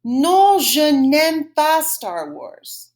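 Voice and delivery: slow, loud, drawn-out French model; repeat-after-me model. Shown as a demonstration. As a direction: say it slowly for a class to copy. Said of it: "Say it after me".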